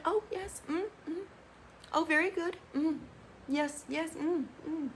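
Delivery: soft, high, prim little voice; imitating her fake-polite hum; mock demure. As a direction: play the meek, proper girlfriend. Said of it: "she's talking like this".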